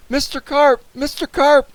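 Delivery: very high, loud calling; yell. A narrator's reading. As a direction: call out urgently for a missing man. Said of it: "I yelled".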